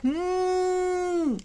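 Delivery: high, long mooing ending; drawn-out moo. Acted. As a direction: stretch the moo like a cow. Cow sound in refrain.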